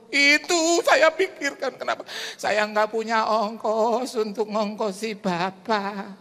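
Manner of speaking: wailing like the crying widow on the phone; old widow crying. Retold or imitated. Widow weeping to the pastor, high and tearful.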